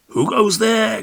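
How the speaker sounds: high sentry challenge; called out. A narrator's reading. Will Sentry calling out to the flying moon, high. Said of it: "called out Will Sentry".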